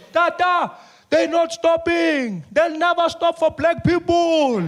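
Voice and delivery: high, slow, whining plea; panicked plea. Acted. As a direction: whine it high and stretched out. Siphiwe panicking pleading.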